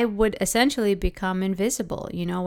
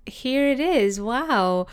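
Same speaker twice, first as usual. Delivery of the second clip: higher-pitched mock surprise, falling back on wow; feigned accidental surprise; 5 semitones higher, slower than usual. Speaker pretending attention came by accident, feigned surprise. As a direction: act innocently caught off guard. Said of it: "like it was incidental".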